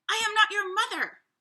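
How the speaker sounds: loud, high-pitched scolding; stern. A narrator's reading. Bear refusing to be the goslings' mother, stern shout. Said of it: "Bruce was very stern".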